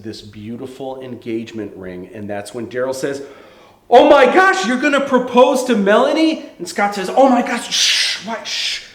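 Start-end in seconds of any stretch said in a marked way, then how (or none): 3.9-6.4 loud, high gasp of excitement; astonished outburst. Acted. Friend seeing the engagement ring, astonished outburst. 7.1-8.8 high, urgent hushing; panicked shushing. Acted. Man hushing his friend about the proposal, panicked shushing.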